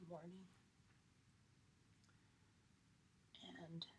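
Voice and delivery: soft, whispery, exhausted; worn-out sick voice. Real, not acted. Sick podcaster telling listeners she's still ill, soft and exhausted. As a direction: barely get the words out, drained by illness. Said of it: "my fucking throat just gets fucking tired".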